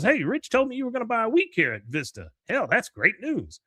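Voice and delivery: put-on high-pitched pleased old man; acted delight. Cotton hears of Hank's purchase, high mock delight. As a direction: exclaim it high, thrilled for your son.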